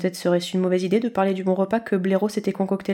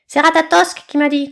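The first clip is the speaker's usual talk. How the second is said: much higher, loud squawk; shrieking eaglet; 7 semitones higher, 7 dB louder than usual. A narrator's reading. Eaglet shrieks at Blaireau about the snake. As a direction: scream the accusation. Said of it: "s'égosilla".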